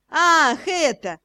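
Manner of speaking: short sly giggle through the words; giggling. A narrator's reading. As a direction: snicker it out, amused. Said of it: "хихикнул ворон".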